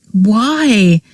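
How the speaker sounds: pained, understated cry; a hint of agony in his voice; agonized. A narrator's reading. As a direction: ask it in anguish. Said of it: "he said in an agonized voice".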